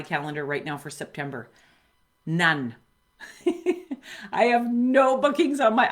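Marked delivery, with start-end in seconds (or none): 3.2-4.3 short high nervous giggle; a jittery burst of laughter. Real, not acted. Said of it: "it's a nervous laugh". 4.3-5.9 laughing nervously through the words. Real, not acted. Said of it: "I'm laughing 'cause it's a nervous laugh".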